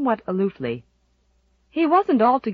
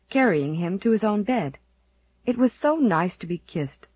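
ordinary delivery

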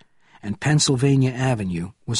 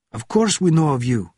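high, raised, agitated reply; officer's cry; 5 semitones higher, 4 dB louder than usual. A narrator's reading. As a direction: cry it out, exasperated. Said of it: "the officer cried".

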